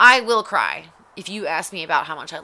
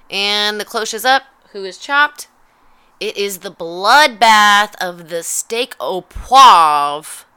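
big, slow, loud reveal announcement; mock TV announcer unveiling the loser; dramatic announcer; 11 dB louder, slower than usual. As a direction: boom it out like a game show host. Announcing the chopped result.

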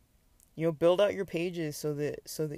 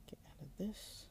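whispering; 3 semitones higher, 16 dB quieter than usual. Real, not acted. Muttering while navigating page.

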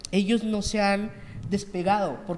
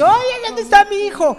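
much higher, loud pleading mother; desperate mother; 13 semitones higher, 8 dB louder than usual. Retold or imitated. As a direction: cry out like a frantic searching mother. Mothers with photos crowding him.

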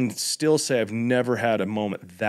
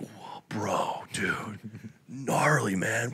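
softer, hushed amazement; awed whisper; 8 dB quieter, much slower than usual. Retold or imitated. The crowd whispering in awe at the calm dude. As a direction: whisper it like a stunned onlooker.

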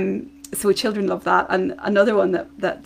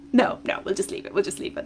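whisper; faster than usual. Retold or imitated. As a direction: hush it so the child can sleep. Bedtime goodbye game, hushed.

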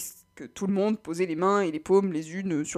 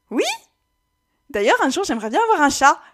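excité; 7 semitones higher, 10 dB louder than usual. Acted. Personnage enthousiaste rêve d'un chat.

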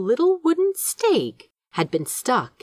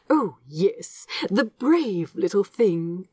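lightly voiced, proud and fond; proud Aziraphale. A narrator's reading. Aziraphale proudly praising his plant to Crowley, fond and pleased. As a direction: puff up with pride for the little plant. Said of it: "Aziraphale said proudly".

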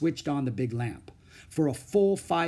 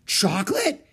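a gasped chocolate?, higher; 8 semitones higher, 5 dB louder than usual. A narrator's reading. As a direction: nurse gasping in disbelief. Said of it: "gasped Nurse Farthing".

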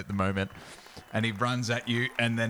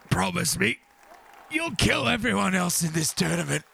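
high, strained dying request; winded fighter's plea; 9 semitones higher than usual. Acted. Beaten Killington on his knees before the victor, high plea. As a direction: plead high, between gasps of defeat. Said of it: "and he goes".

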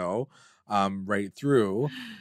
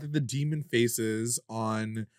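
ordinary talk, nothing marked

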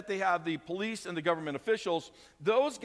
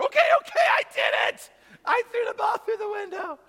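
much higher, loud, panicked blurt; shouted confession; 6 dB louder than usual. Retold or imitated. Caught child to parents, shouting confession. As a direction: burst out a desperate confession.

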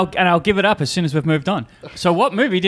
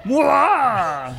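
mock villain cackle; short hammed-up evil laugh; 10 semitones higher than usual. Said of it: "that guy had an evil laugh".

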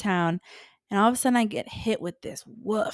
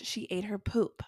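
horrified whisper; 7 dB quieter than usual. Retold or imitated. Retelling finding the dog's poop, whispered. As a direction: whisper it in disgusted shock. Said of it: "And I am like".

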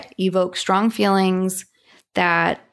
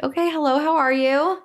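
mock polite greeting; 8 semitones higher than usual. Greeting the unwelcome devil guest.

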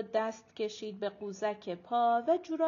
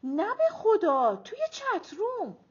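amused; 10 semitones higher, 7 dB louder than usual. A narrator's reading. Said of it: "آیه زد زیر خنده".

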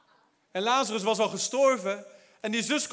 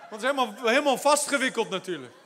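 ordinary talk

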